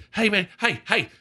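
high, pestering caller; lightly mock a fan calling over the fence; imitated pleading fan.

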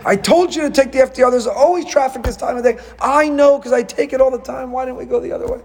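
high-pitched squabbling couple; mock bickering. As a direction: act out an exasperated car argument. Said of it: "a fight would ensue".

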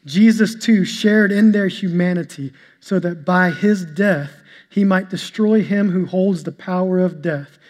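ordinary delivery

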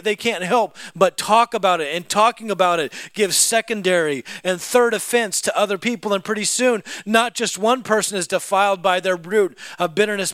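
loud, high preaching cadence; full-force preaching. Acted. Preacher driving home his point at full force. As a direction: preach it hard to the congregation.

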